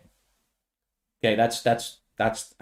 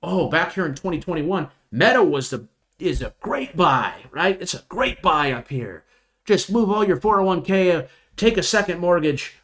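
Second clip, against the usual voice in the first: Jim Cramer; 6 semitones higher than usual. Retold or imitated. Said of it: "Jim Cramer was on C N B C saying".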